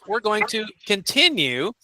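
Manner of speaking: ordinary delivery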